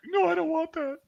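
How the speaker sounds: very high, squeaky whimper; hammed-up high, whiny protest; tearful pleading. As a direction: imitate a recruit about to cry. Recruit near tears at being sent home.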